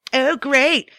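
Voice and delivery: dry mock cheer; sarcastic. Sarcastic complaint.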